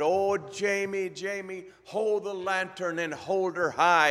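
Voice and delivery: urgent Scottish doctor, high and loud; hammed-up Scottish doctor's command; Scottish brogue. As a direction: call out the order in a put-on Scottish brogue. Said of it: "best Scottish brogue I can come up with".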